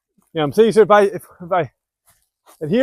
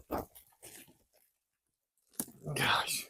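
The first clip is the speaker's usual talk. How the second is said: whisper; 3 semitones lower, 17 dB quieter than usual. Real, not acted. Frustrated with short tie wire.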